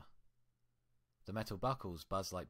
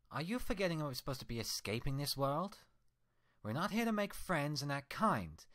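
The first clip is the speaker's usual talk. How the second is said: higher, louder cold retort; defiant reflection; 8 semitones higher, 6 dB louder than usual. Acted. The reflection justifying herself to Twilight, defiant. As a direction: push back hard, unrepentant.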